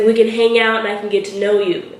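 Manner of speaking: normal talking voice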